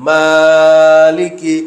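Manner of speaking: মালিকি as one word, very slow, the মা stretched one alif long; joined and drawn out. Shown as a demonstration. Teacher modelling the joined word for pupils, drawn out very slowly. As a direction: glide through মালিকি in one long breath, leaning on মা. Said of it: "একসাথে".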